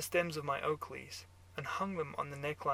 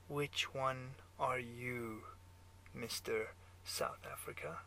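put-on German accent; 2 semitones lower, 5 dB quieter, slower than usual. A narrator's reading. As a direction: lean in quietly with a German accent. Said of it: "your German accent".

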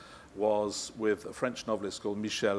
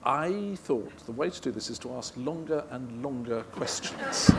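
ordinary delivery

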